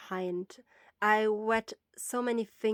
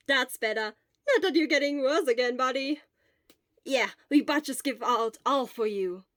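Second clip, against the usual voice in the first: cheerful; 4 semitones higher, 7 dB louder than usual. Acted. Friends encouraging sick boy.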